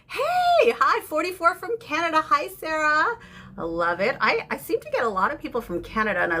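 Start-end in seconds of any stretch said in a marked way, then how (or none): 0.1-3.2 delighted to see a new viewer; greeting a Canadian viewer with a high squeal; excited hey. Real, not acted.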